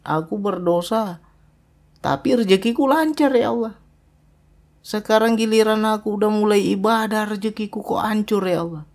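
mock a believer keeping score with God; complaining to God. Preacher imitates a worshipper complaining about lost wealth. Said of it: "Komplain pula kita sama Allah".

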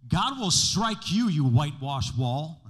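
loud, slow fiery rebuke; loud slow mock outrage. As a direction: preach it like Paul rebuking the priest. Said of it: "he says".

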